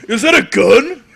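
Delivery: high, loud mock alarm; yelped question in comic panic; hammed-up shocked shout; Triple H impression. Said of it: "you do the best, uh, Triple H".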